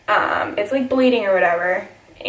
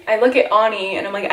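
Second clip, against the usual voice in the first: usual speaking voice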